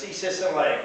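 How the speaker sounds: ordinary delivery, nothing marked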